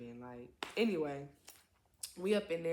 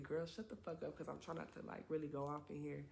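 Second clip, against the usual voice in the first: hushed; 3 semitones lower, 10 dB quieter than usual. Real, not acted. Keeping voice down walking past.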